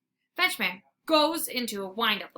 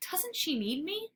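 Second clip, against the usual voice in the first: whisper; 7 semitones higher, 6 dB quieter than usual. A narrator's reading. Sad dog wondering.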